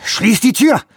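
high, loud command; urgent shout. Acted. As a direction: shout the order. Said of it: "rief Johansson".

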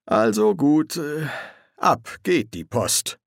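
hesitant, slow false cheer; halting, uneasy send-off; nervous. A narrator's reading. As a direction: stall awkwardly before moving on. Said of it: "fuhr Crowley nervös fort".

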